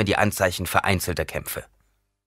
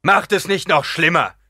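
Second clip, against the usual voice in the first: higher, louder call; 10 semitones higher, 6 dB louder than usual. A narrator's reading. Gurney calls to his men not to make it worse. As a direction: call out to calm them.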